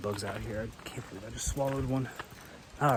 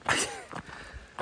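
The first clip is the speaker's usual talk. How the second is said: breathy laugh; 5 dB quieter than usual. Real, not acted. Laughing at the bug.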